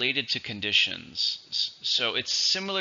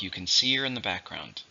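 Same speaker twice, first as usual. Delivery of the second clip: ordinary delivery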